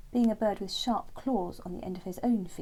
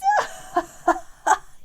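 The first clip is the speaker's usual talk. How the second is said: high loud chuckle; frog chuckle; 10 dB louder than usual. Acted. Frog still laughing at Terence, high loud chuckle. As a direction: cackle over the joke. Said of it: "he chuckled".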